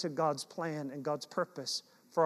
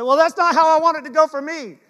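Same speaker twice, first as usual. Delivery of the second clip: high, loud whiny protest; indignant complaint; 9 semitones higher, 11 dB louder than usual. Retold or imitated. Son protesting to his dad, in a high loud whine. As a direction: sound put out, like a son who got no help.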